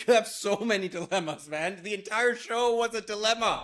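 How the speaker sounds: high-pitched mock outrage; teasing exasperation. Real, not acted. Host ribbing a friend over endless dilemmas, mock-exasperated.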